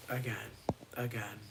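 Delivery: normal talking voice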